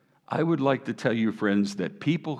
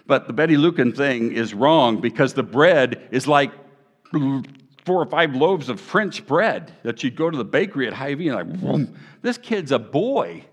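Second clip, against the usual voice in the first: higher and louder, building; full-force preaching; 6 semitones higher, 5 dB louder than usual. Real, not acted. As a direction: preach it out to the crowd.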